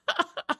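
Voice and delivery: quick high-pitched giggle; can't hold back the laugh; squeaky burst of laughter. Real, not acted. Laughing at the scuffle.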